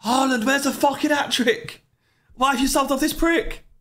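over-the-top mock fury; fuming mock rant. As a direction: rage at Haaland like a betrayed fantasy manager. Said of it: "you'd be fuming".

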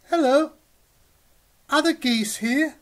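put-on high, loud baby-goose voice, asking eagerly; high little gosling. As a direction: call out like a tiny lost gosling. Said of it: "And Goz says".

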